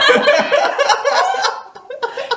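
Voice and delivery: high loud laughing fit; burst of laughter. Real, not acted. Forgets her line and bursts out laughing. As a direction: cracks up helplessly.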